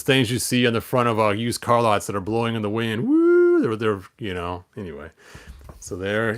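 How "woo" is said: whooping woo. Pretend. Mimics flag blowing in wind.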